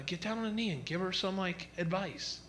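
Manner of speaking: hushed wife. Retold or imitated. Wife whispering to husband at preschool, hushed. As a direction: nudge him quietly aside.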